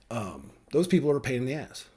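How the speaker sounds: ordinary talk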